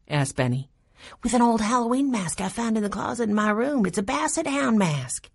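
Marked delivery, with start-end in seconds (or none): 1.2-5.3 put-on higher, quick girl's voice; scheming Lainey. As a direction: let the prank plan out in a light, high voice.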